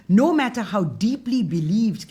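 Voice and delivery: normal talking voice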